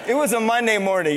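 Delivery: ordinary delivery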